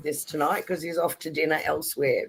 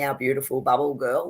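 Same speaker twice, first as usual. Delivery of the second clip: ordinary delivery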